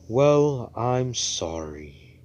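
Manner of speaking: high loud "Well", then drawn-out "sorry"; exaggerated apology. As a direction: stretch a hammed-up grudging apology. Grudging apology, first exaggerated take.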